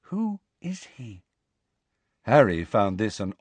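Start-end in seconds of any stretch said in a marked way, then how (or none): 0.0-1.2 whisper. A narrator's reading. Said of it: "she said in a whisper barely audible".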